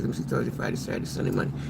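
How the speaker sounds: whispered, counting "Thursday, Friday, Saturday" to herself; counting under breath. Real, not acted. Working out her trip length aloud, whispering the days. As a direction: tick off the days in a hush.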